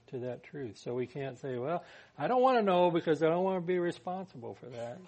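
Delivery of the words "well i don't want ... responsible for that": excuse-making. Acted. Voicing a person's excuse.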